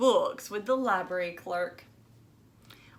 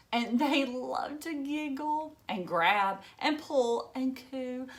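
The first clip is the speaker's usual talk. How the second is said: ordinary delivery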